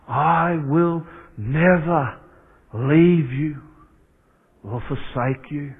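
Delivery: slow, weighty promise; slowed emphasis. Acted. Pastor slowly stressing Jesus' promise to never leave. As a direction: draw each word out.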